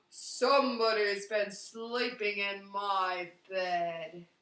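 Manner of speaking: big slow loud bear voice; slow Papa Bear. Acted.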